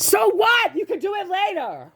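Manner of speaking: high, exasperated snap back; heated retort. Real, not acted. To husband complaining about her stuff, heated retort. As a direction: cut him off angrily.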